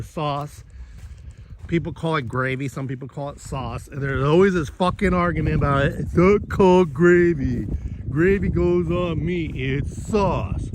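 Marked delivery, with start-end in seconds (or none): 6.0-10.7 louder, higher, slower, stubborn correction; mock indignant insistence. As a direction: insist it's sauce, not gravy. Acted gravy argument, loud voice.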